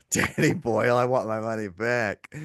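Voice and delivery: hammed-up theatrical outrage, stretched out; mock melodrama. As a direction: imitate a friend being overly dramatic. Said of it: "You're sounding a little bit dramatic".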